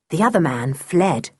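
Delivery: plain reading voice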